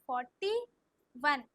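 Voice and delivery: Forty rising, pause, then वन; slow dictation. Shown as a demonstration. Teacher models the number for pupils, slow and split. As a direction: split forty and वन with a clear gap. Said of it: "slow slow बोलो".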